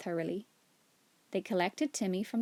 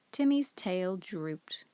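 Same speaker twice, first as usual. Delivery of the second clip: ordinary delivery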